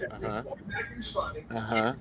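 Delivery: retold hushed uh-huh of a shocked man; stunned quiet. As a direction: go quiet, too stunned to talk. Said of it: "that's when it got real quiet".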